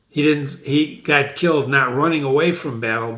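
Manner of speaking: ordinary delivery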